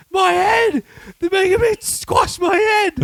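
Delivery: very high, drawn-out hammy wail; high-pitched panicked impression; mock freak-out. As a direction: imitate him freaking out over an injury. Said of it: "the way he starts freaking out".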